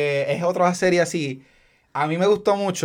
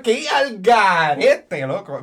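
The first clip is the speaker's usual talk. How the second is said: mock scolding; 5 semitones higher, 4 dB louder than usual.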